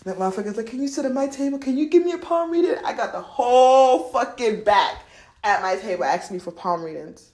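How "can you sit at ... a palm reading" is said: high, rising plea; pitched-up mock begging; eager pleading request. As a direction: imitate an eager diner asking a favor. Imitates people pleading for readings.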